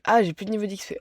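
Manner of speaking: loud, annoyed cry of dismay; frustrated outburst. Real, not acted. Runs out of XP, frustrated.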